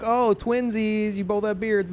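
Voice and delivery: imitating a lighthearted observer; mock cutesy remark. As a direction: put on a playful twinsies coo. Mimics the milder reaction clerk didn't have.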